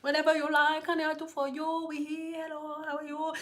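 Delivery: quiet, airy, sweet talk; a gentle, fading mock-polite voice; soft sweet hair voice. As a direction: coo it softly like a pushover. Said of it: "So they just".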